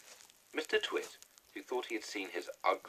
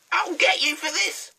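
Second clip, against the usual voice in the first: much higher, louder furious witch yell; yelling hag; 14 dB louder than usual. A narrator's reading. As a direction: bellow insults from the sky like a wild hag. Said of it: "shouted Missus Twit".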